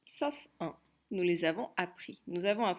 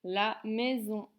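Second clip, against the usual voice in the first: slow, clear dictation; dictation pace; 3 semitones higher, slower than usual. Shown as a demonstration. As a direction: dictate it slowly. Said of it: "essaye de m'écrire la maison". (dictée lente et claire)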